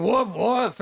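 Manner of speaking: loud playful woofs; mock dog barks. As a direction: bark it out like a dog on all fours. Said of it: "start barking like a dog".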